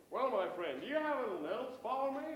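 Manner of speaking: loud, hearty mock-American tourist; American accent. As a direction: greet him in a big put-on American accent. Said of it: "American returned".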